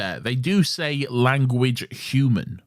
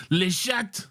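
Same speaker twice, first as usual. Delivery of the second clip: squeaky French; 10 semitones higher than usual. Pretend. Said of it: "mocking schoolboy French".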